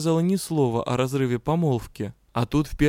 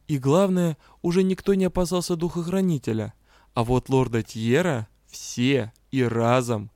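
ordinary delivery, nothing marked

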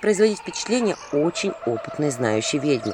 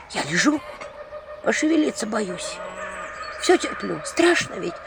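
frightened whisper; 4 semitones higher than usual. Acted. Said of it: "в ужасе пошептала баба".

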